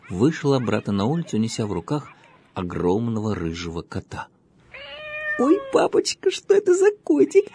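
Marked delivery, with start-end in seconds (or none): 5.4-7.4 put-on high, delighted little girl squeal. A narrator's reading. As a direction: squeal it out with joy. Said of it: "завизжала от восторга девочка".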